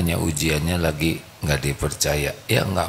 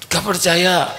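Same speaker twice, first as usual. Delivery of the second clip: higher, curt disbelief; dismissive wife; 12 semitones higher, 4 dB louder, slower than usual. Acted. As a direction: brush him off like an unconvinced wife. Wife refuses to believe. (istri ketus tidak percaya)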